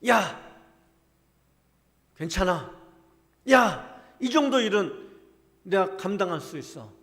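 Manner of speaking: self-encouraging shout. Pretend. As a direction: psych yourself up out loud. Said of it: "하나님이 지금 나를 워밍업 시키고 계시다".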